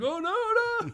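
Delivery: very high, loud comic wail; mock panic. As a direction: cry out in hammed-up alarm. Acted panic on the moped.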